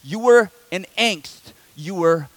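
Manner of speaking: slow emphatic preaching. Acted. Quoting Paul in sermon.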